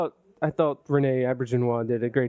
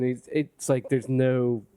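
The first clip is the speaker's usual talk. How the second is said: usual speaking voice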